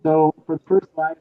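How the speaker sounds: loud, projecting into the mic; talking loud. Real, not acted. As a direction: speak up so the room hears. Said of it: "I'm gonna just try and talk loud".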